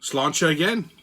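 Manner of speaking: whimsical Irish lilt; Irish accent. Pretend. Host ranting to listeners as an Irishman, whimsical Irish accent. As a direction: put on a playful Irish brogue. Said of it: "enough of the Irish accent for now".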